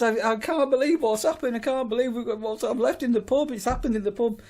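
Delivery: high, loud hammed-up distraught wife voice; a wife crying over what she left in the pub, overacted; mock wailing wife. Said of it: "And she says".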